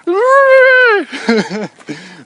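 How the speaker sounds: very high, loud squeal into a laugh; comic high-pitched noise, then laughing; random weird noise. Pretend. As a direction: let out a silly drawn-out squeal. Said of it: "You just make noises".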